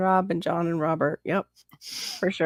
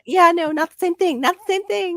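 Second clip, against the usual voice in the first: high mock voice; 13 semitones higher, 3 dB louder than usual. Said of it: "I'm like".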